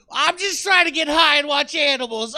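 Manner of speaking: usual speaking voice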